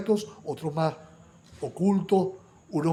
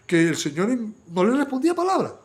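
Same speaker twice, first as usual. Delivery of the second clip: ordinary delivery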